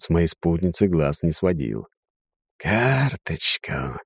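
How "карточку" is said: slow, honeyed seductive purr; silky serpent voice. A narrator's reading. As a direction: coax it like a tempting snake. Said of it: "куда как более ласковым голосом".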